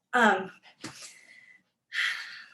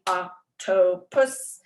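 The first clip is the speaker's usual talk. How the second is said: "octopus" very slow, louder, in three beats; syllable tapping; 5 dB louder, much slower than usual. Shown as a demonstration. Teacher mimics arm tapping, "octopus" in separate beats. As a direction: tap out "octopus" as oc, to, pus. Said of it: "They do arm tapping".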